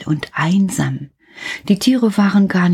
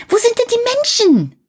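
put-on high child's voice calling upward; a curious boy shouting up a tower; calling up as Häwelmann; faster than usual. A narrator's reading. Häwelmann calls up to the rooster, high and curious. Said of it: "rief der kleine Häwelmann hinauf".

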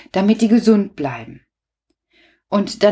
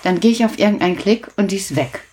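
ordinary talk

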